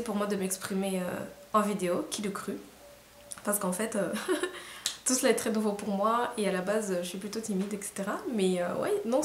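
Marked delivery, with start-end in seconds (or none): 4.2-5.0 short breathy giggle, high; a quick embarrassed giggle; shy giggle. Real, not acted. Admitting to viewers she is shy, with a shy giggle. Shy admission, laughs at herself.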